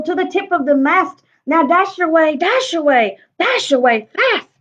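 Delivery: lively rhyming read-aloud; story narration. Acted. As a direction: read it with a bouncy rhythm to children. Reads the picture book aloud.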